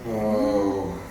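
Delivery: ordinary delivery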